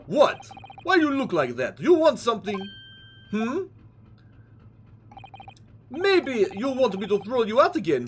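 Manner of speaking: high, loud menacing Russian sailor; Russian thug voice. Acted. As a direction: threaten them as a gruff Russian guard. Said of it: "all the Russian guys have the same exact voice".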